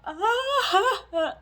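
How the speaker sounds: loud, high mock sob; hammed-up wail of crying; re-enacted bawling wail. Storyteller re-enacting her phone call to Doug, mock bawling wail. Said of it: "I'm bawling".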